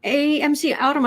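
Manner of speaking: usual speaking voice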